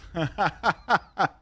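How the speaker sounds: high-pitched burst of laughter. Real, not acted. Streamer reacting to a tattoo story, laughing. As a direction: laugh out in a quick high run.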